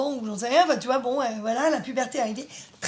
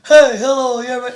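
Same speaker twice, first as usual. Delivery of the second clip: much louder mock teen with a broken voice; imitating broken voice; 15 dB louder than usual. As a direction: ham up the kid who suddenly sounds grown. Imitates boy whose voice broke. (imitation moqueuse d'un ado qui a mué, beaucoup plus fort)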